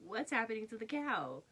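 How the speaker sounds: high, loud baffled question; startled question. Real, not acted. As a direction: ask it in disbelief. Puzzled by the card image.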